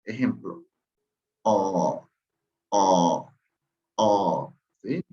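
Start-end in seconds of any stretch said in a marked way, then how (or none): 1.4-4.5 "O" sounded as an A with the mouth held in an O shape; teacher showing the A with diaeresis; A with rounded O lips. Shown as a demonstration. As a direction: hold the O mouth and voice an A. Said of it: "pronuncien la A. Ejemplo".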